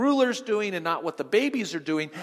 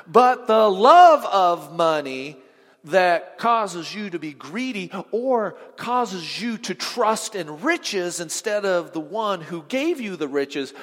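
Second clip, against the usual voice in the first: 1 emphatic warning; 7 dB louder, slower than usual. Real, not acted. Preacher stressing greed warning.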